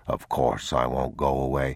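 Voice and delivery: put-on calm a frightened child; soothing father. Father reassures.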